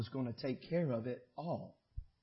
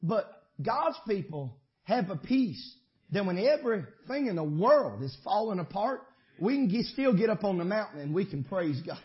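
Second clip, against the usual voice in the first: normal talking voice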